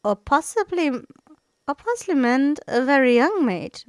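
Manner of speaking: high, loud teasing voice; playful suggestion. Acted. The bard teasing the table, high and playful. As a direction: toss the idea in lightly. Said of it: "the bard suggested".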